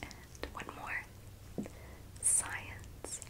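breathy whisper right at the ear; whisper in left ear. Shown as a demonstration. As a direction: close ear whisper test words. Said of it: "I'm just going to whisper into your ear".

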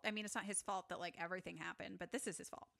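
normal talking voice

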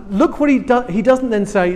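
ordinary talk, nothing marked

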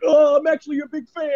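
high, far put-on fan voice; mock fan. Imitating a fawning fan's high, gushing reply. Mocking a fan's fake compliment.

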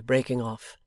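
ordinary delivery

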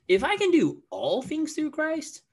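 "all" pitched very high, loud and questioning; emphasis on ALL. Retold or imitated. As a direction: lift "all" way up as if just realizing it. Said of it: "I would emphasize different words".

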